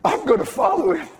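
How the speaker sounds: high, full-voiced cry; shouted vow. Real, not acted. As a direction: burst out and proclaim it. Whisper then shout of same line.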